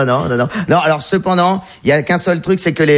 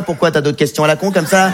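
normal talking voice

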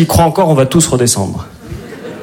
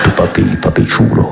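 low breathless reggaeton murmur; reggaeton Vader; 6 semitones lower than usual. Pretend. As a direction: croon it like a cheesy reggaeton hook. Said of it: "la langue du reggaeton".

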